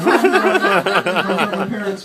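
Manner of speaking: high hammed-up begging, then trailing off; mock pleading. Caught kid begs cops not to tell parents, mock panic. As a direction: play the scared kid pleading.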